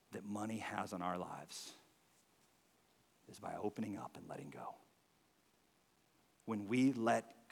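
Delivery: hushed, low, heartfelt sermon voice; quiet, solemn appeal to a congregation; earnest preaching. Real, not acted. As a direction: drop low and soft, with weighty pauses.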